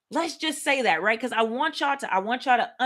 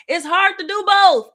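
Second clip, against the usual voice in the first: raised high and loud for emphasis; emphatic; 8 semitones higher, 8 dB louder than usual. Real, not acted. Preacher to congregation, loud and emphatic. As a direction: hit it hard and high.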